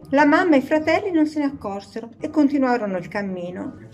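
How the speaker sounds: usual speaking voice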